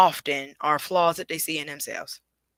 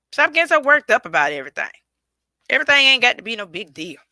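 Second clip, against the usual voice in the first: louder, faster preacher push; preaching; 5 semitones higher, 5 dB louder, faster than usual. Real, not acted. Preaching to viewers to stop getting worked up, louder and fast. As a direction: preach it at the camera, fast and loud. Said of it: "I'm kinda preaching a little bit".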